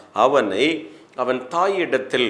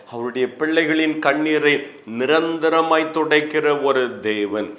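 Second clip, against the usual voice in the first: normal talking voice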